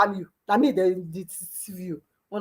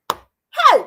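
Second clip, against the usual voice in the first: high, loud outburst of disbelief; shocked loud hey; exasperated cry; 8 dB louder than usual. Real, not acted. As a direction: throw up your hands with a sharp hey. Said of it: "this story too much".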